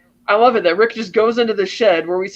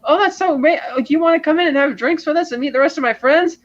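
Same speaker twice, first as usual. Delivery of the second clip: much higher, bubbly gushing invitation; excited Sharon; 8 semitones higher than usual. Retold or imitated. Sharon over the intercom inviting Rick in, thrilled. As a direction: gush with delight. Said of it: "It's like".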